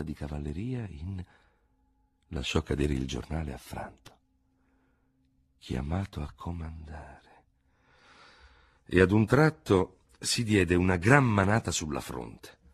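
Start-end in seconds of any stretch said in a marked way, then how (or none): 5.6-7.2 "Chiamato a comandare" repeated slowly and much softer; dazed slow repetition of "Chiamato a comandare"; stunned murmur. Acted. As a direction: let the words sink in, barely aloud. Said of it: "sarà chiamato a comandare".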